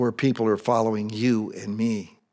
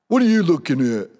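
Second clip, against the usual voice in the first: confrontational, louder challenge; squaring up to a stranger; tough guy attitude; 5 semitones higher, 6 dB louder than usual. Retold or imitated. Biker mimics a tough guy challenging him. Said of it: "they have the attitude like".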